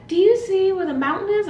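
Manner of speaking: put-on high, loud, sing-song kids' host question; mock Dora voice. Mimicking Dora asking kids at home, high and chirpy. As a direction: chirp it like a cartoon host quizzing the viewer.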